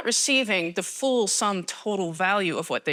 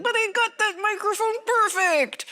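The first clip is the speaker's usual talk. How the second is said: high, far-off put-on voice of a fussy sound tech; mock complaint from the AV guy; whiny AV guy; 14 semitones higher than usual. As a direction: squeak it out like the wounded sound guy. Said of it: "the A V guy is going to be like".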